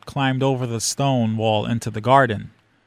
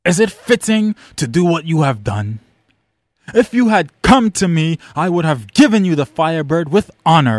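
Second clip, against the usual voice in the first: King Dolmat, stern; 4 semitones higher, 5 dB louder than usual. Acted. King scolds then bargains.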